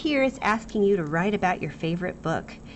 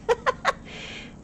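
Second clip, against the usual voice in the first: breathy giggle. Real, not acted. Laughing at own overacting.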